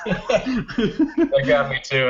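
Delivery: laughing high, slow; real laugh. Real, not acted. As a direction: laugh through the words, genuinely amused. Amused reaction to the quoted line.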